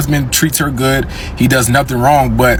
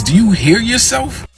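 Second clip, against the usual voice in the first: incredulous; 10 semitones higher than usual. Real, not acted. Scolding ladies in disbelief.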